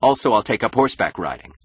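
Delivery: mildly angry. A narrator's reading. Husband catching himself, then adding a plan with mild anger. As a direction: add it with understated anger. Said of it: "and added angrily".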